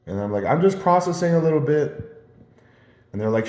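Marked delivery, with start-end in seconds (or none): 0.4-2.2 raised, higher-pitched mock call; calling back. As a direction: re-enact shouting an answer across a distance. Acted reply, calling back to coworkers.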